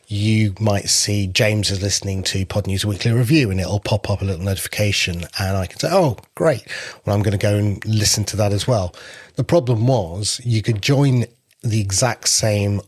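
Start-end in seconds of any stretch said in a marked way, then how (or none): none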